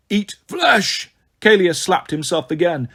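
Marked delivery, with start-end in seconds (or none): 0.1-1.1 high, strangled ghoul cry; ghoulish outburst. A narrator's reading. Ghoul slipping before his king, hungry outburst. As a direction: blurt it like a ravenous ghoul.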